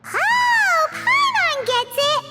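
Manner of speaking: high, drawn-out squeal of understanding; excited realization. Acted. Companion suddenly working out what Monica wants, excited realization. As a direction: burst out with a sudden bright idea.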